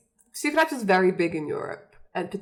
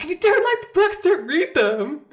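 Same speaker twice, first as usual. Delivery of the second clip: high, loud mock outburst; indignant fan retort; 9 semitones higher, 7 dB louder than usual. Gatekeeping fan Becky scolds the questioners, mock indignant outburst. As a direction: snap it out as an indignant superfan. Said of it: "And she's like".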